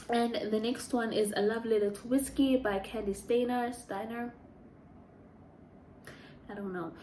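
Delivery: usual speaking voice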